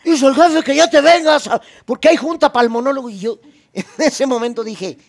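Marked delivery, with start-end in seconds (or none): 0.0-3.1 high, loud mock messenger voice; shouted relay of the boss's order; imitating the chauffeur. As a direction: play the errand guy, pitched up. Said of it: "y me dice".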